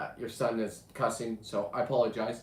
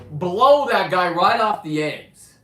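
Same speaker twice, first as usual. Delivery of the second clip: slow shout; 5 semitones higher, 10 dB louder, slower than usual. Retold or imitated. Retelling the kill, slow shout.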